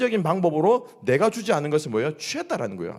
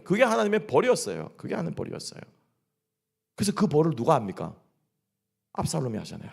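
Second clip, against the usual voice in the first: ordinary talk, nothing marked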